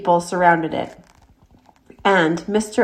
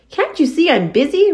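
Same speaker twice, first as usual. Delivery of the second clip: disgusted; 9 semitones higher, 4 dB louder than usual. A narrator's reading. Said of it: "replied Avery in disgust".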